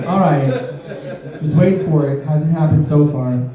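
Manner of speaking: ordinary talk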